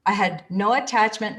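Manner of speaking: ordinary talk, nothing marked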